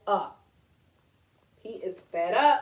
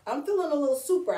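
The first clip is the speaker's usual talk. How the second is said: plain reading voice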